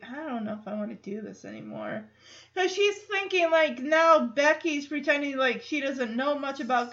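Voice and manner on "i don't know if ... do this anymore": put-on quiet, let-down little girl; deflated. Michelle giving up on the car, deflated and quiet. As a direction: mumble it like the fun's gone. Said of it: "She is just deflated".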